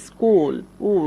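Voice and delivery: স্কুল, then its vowel উ alone as "oo"; "oo" sound shown. Shown as a demonstration. Teacher lets learners hear double O, স্কুল then "oo" as উ. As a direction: say স্কুল and single out the "oo" of উ. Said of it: "তাই উ sound হচ্ছে".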